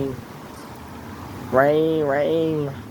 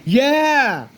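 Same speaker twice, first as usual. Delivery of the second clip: in a hailstorm; 8 semitones higher, 4 dB louder than usual. Real, not acted. Reassuring family during hail, raised.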